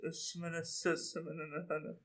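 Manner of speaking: hushed, slow murmured gibberish; possessed sleep-talker. Retold or imitated. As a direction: imitate a sleepwalker's soft, drawn-out babble. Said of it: "now she's like this".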